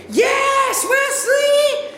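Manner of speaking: high, loud cheer; screaming. Retold or imitated. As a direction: yell it in triumph. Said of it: "I scream".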